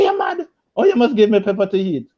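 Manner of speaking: high squeal, then a quick indignant rush; shocked outburst. Retold or imitated. Husband protesting to his wife across the table, squealing. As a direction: start in a squeak, then hurry the complaint.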